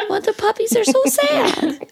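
high, laughing whimper. Real, not acted. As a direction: squeak it out through laughter. Laughing over sad puppies.

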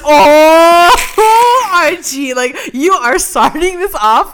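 high, loud, drawn-out gasping "oh"; hyped shock. Retold or imitated. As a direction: exclaim it like a thrilled viewer reacting to a showdown. Retold shocked reaction to the scene.

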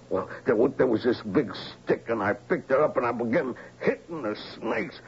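normal talking voice

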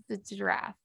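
softly sounding it out to yourself; whispered try-out. Shown as a demonstration. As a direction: whisper the word to test it. Testing the word to herself.

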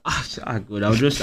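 ordinary talk, nothing marked

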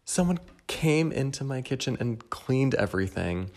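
playful fake astonishment; mock amazed. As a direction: gasp at the clean kitchen as if someone else did it. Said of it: "every time I'm like".

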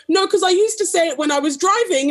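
high, hurried self-defence; flustered explaining. Retold or imitated. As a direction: scramble to explain. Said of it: "I was like".

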